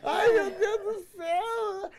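slow, high, loud wailing; mock crying. Stoned wife overwhelmed by it all, high drawn-out mock wail. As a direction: drag it out as a hammed-up sob. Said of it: "ela começou a chorar".

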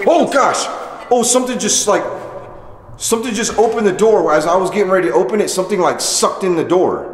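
high, loud, rushed fright; jolted by a door opening itself; startled and scared. Real, not acted.

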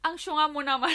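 mock her sister fondly; teasing laugh. Teasing her sister about the earring, laughing. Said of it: "tinawanan ko siya".

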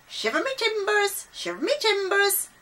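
high-pitched parrot screech; loud squawking parrot. A narrator's reading. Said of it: "heard a loud voice".

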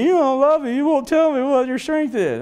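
high, pleading mock sob; whiny crying Delilah. Delilah pleads tearfully with Samson, high mock whine. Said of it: "she starts crying again".

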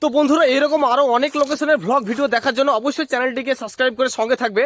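loud upbeat sign-off; energetic outro. Acted. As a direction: pitch it like a YouTube outro. (উচ্ছ্বসিত সমাপ্তি ঘোষণা)

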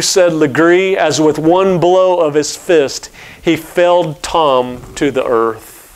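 ordinary delivery

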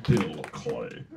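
deep, drawn-out movie villain; low villain imitation of a film line; Alan Rickman. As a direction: drop low and savour the name. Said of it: "That's my Alan Rickman".

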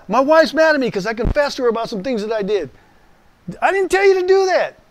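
very high, loud outburst; exasperated shout. Retold or imitated. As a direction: let it burst out, very high. Acted caller and pastor reply.

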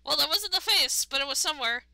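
gleeful. Real, not acted. Jokes after hitting.

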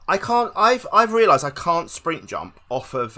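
high, loud frustrated realisation; exasperated outburst. Real, not acted. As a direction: burst out in frustration. Frustrated realisation after failing.

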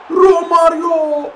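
high, long-held name; drawn-out excited call. Real, not acted. Gamer calling Romario's chance, excited.

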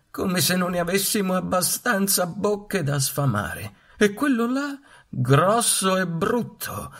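queen duck. A narrator's reading. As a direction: look down on the brood as the queen duck. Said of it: "l'anatra regina ... disse". (anatra regina altezzosa, voce alta)

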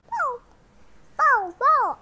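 high, loud woof sounds; dog barking. Pretend. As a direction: bark like a playful dog. Child voices toy dog.

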